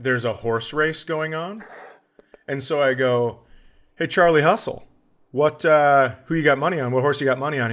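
normal talking voice

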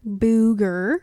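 "booger" with a long oo and a lifted ending; booger with oo sound. Shown as a demonstration. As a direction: say booger as BOO-ger, rising at the end. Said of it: "yes, I say booger".

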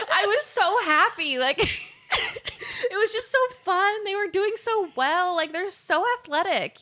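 high, bright-eyed gushing, a laugh inside; gushing excitement. Real, not acted. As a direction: gush about how fun it was. Gushing happily about the show.

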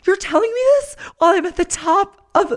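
high, incredulous complaint; mock exasperation, high. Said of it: "I'm like".

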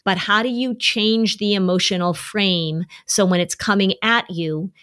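normal talking voice

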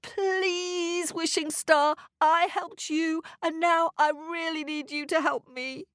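put-on high, earnest boy's plea; fervent wishing. A narrator's reading. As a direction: wish hard, pleading with a star. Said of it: "wished harder than he'd ever done".

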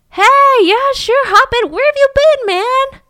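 high loud mock-casual; [name] pretending to know Kenny, loud and high; hammed-up buddy greeting. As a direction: play along like an old friend. [name] plays along.

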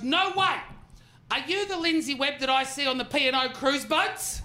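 high, thrilled squeal of recognition; excited fan lady spotting a comedian. Retold or imitated. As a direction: gush like a starstruck fan. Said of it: "She goes".

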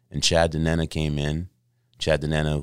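ordinary delivery

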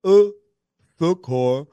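loud French "oh", pause, then "secours"; exaggerated French accent, split into two parts. Shown as a demonstration. Host demonstrating "au secours" for cohost, French accent. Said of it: "If you wanna do a French accent".

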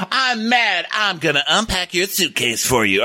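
high, hammed-up mad Susan; mocking Susan's anger, high and exaggerated; mock angry wife. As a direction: squeak it like a fake tantrum. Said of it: "She's like".

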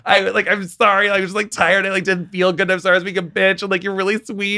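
high-pitched reenacted video apology; pleading apology. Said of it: "I had to send her a video".

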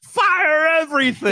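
high, drawn-out shout; mock battle cry. Co-host interrupts the movie pitch, hammed-up shouted command.

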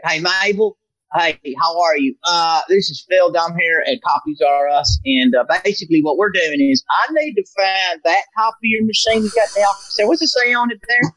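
high, chirpy cold-call salesman voice; telemarketer voice. Retold or imitated. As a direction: sweet-talk the receptionist like a pushy phone seller. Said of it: "doing a lot of different accents".

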